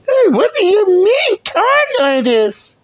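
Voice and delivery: very high, put-on mimicking voice. Reactor echoing the woman in the clip, high mocking voice.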